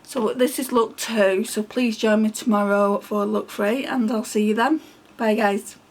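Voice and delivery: normal talking voice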